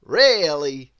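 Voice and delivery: confident booming storybook hero; Mister Impossible voice. A narrator's reading. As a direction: boom it out like a proud show-off. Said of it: "said Mister Impossible".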